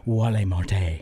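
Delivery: mock foreign Walmart. Friends joking about the store name, mock foreign. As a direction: pronounce it Wah-lay Mar-tay.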